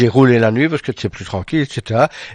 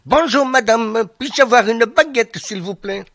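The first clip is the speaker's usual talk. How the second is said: Parisian accent; 9 semitones higher than usual. Shown as a demonstration. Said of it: "avec un peu l'accent de là-bas".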